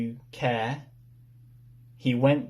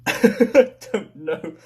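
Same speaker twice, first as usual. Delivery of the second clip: laughing; 6 semitones higher, 8 dB louder than usual. Real, not acted. Laughing at losing the thread.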